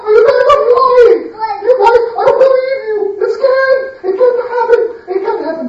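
very high, loud outraged cry; disbelieving father. Acted. Father crying out at his sons' lie, high loud outrage. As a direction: refuse to believe it, furious and shaken.

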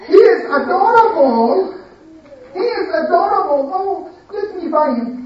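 high, loud, swooning gush. Shown as a demonstration. Lady gushing over the adorable boy, high and swooning. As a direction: gush over him like in the movie. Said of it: "Can you say it like you did in the movie".

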